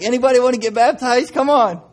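high, loud, booming altar call; a minister's big invitation shouted to the church; loud preacher call. Retold or imitated. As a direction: call the crowd forward like an eager minister. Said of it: "he was just like".

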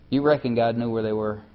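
ordinary talk, nothing marked

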